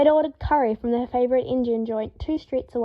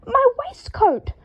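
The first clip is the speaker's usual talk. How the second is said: very high, slow screech; 12 semitones higher, 4 dB louder, slower than usual. A narrator's reading. Draco spotting his waistcoat, screeching. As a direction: screech in horror. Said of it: "screeched Draco".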